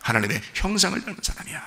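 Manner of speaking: gentle version of the bold claim; quiet declaration. Acted. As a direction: say it softly but with quiet confidence. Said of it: "이렇게 당당하게 사셔야".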